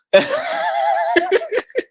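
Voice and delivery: a real high-pitched incredulous laugh; high burst of laughter. Real, not acted. Laughing at walking in America at two AM. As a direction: break into a high cackle at the thought.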